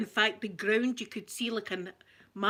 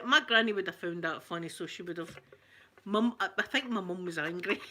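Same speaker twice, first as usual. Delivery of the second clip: ordinary talk, nothing marked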